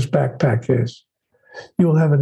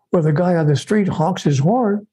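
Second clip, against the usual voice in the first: ordinary delivery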